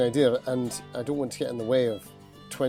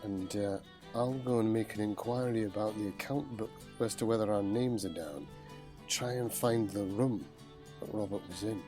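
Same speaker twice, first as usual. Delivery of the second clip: hushed in-character scheming; whispering; 9 dB quieter than usual. Acted.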